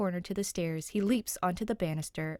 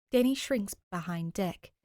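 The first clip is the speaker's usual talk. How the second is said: plain reading voice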